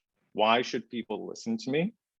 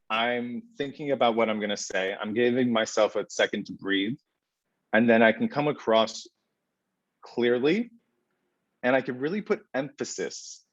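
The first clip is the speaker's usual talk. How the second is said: normal talking voice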